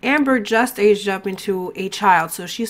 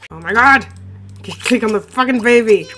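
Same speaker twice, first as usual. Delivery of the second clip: louder, snapping at the game; frustrated yell; 5 dB louder than usual. Real, not acted. Player yelling at the game to pick up the baby, frustrated. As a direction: yell at the stubborn controls. Game ignoring her clicks.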